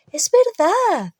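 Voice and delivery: high, slow, emphatic plea; insistent. A narrator's reading. Hermione convincing Hagrid they meant it, slow emphatic insistence. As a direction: insist it's true, earnestly. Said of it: "insistió Hermione".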